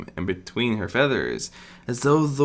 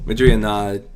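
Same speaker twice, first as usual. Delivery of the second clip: ordinary delivery, nothing marked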